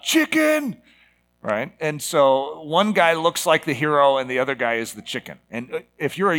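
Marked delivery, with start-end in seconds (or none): 0.0-0.8 high-pitched taunting call; mock yell. Driver passing the one who swerved, mock yell. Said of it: "he yells out".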